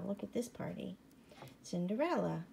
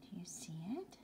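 whisper; 3 semitones lower, 7 dB quieter, faster than usual. Real, not acted. Teasing whisper to child.

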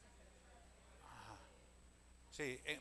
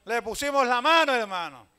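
preaching at full force; 6 semitones higher, 17 dB louder than usual. Acted. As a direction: shout the miracle to the church. Climax of healing testimony. (predicando a gritos, con fervor)

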